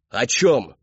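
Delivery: loud, abrupt question; sharp demand. A narrator's reading. Father demanding from his daughter, loud and sharp. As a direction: throw the question at her loudly.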